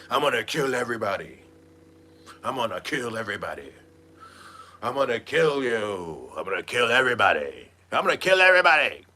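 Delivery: mock Jaguar threats. Imitating Jaguar threatening everyone on live. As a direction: rattle off threats in a put-on voice. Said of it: "she's going live".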